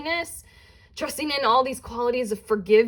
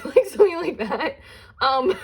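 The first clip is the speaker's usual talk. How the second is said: real giggle breaking through the words; laughing; 6 semitones higher, 3 dB louder than usual. Real, not acted. Tarot reader amused by the ghost costume picture, laughing. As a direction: laugh at the funny image as you talk.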